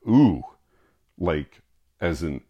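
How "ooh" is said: a loud, high pitched mock wince of "Ooh"; hammed-up pained ooh; singer's ooh. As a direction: imitate the blues singer recoiling, louder. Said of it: "he actually went".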